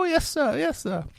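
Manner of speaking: high, timid yes sir; Oliver meekly agreeing. Retold or imitated. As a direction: scrape and bow like a frightened orphan. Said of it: "that's my Oliver impersonation".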